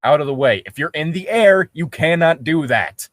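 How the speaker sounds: ordinary talk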